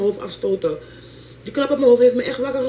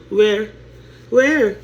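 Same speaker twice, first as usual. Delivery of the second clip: mocking loud question; 5 dB louder than usual. Real, not acted. Spottende tegenvraag.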